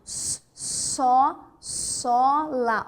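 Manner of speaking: hissed "s", then "só" and "lá" as separate open syllables; sounded-out example. Shown as a demonstration. Teacher giving another S example, sound by sound. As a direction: build "só, lá" from an isolated s. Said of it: "Outro exemplo".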